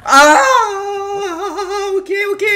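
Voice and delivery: loud high shriek into hurried acceptance; startled scream. Real, not acted. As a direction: scream in shock, stay high-pitched. Startled by the clip.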